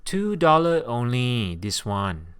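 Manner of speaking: loud, slow Singlish hawker call; Singlish street vendor. Acted. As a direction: call out the price like a Singapore vendor. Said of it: "a street vendor might say to you in Singapore".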